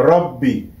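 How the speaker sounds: রব্বি with a held doubled bb. Shown as a demonstration. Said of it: "এভাবে পড়বো". (তাশদিদসহ রব্বি, দ্বিত্ব ব জোর দিয়ে)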